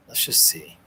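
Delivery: a soft whisper to himself; whispered aside. Real, not acted. Whispering to himself while looking through his collection. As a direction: breathe it under your breath while searching.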